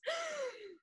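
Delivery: sharp high dramatic gasp; mock gasp. Podcast host gasping at the nail polish reveal, mock shock.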